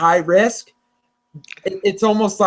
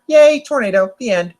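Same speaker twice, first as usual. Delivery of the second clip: mock cheer; 5 semitones higher, 4 dB louder than usual. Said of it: "and I'll be like".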